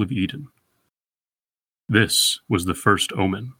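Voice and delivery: slow, deliberate recital of the sonnet; poem reading. Acted. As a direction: let each line ring out, unhurried. Reads the sonnet aloud.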